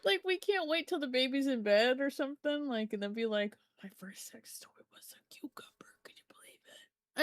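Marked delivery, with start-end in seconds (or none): 3.8-7.2 hushed unvoiced secret whisper. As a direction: whisper it so nobody overhears. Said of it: "I'd be whispering".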